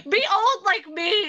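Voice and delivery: laughing line; laughing quote. Acted. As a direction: giggle it out.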